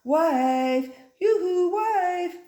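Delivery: high, loud singsong call. Acted. Farmer calling his wife to help, loud singsong call. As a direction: sing out yoo-hoo across the farm. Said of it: "he called his wife".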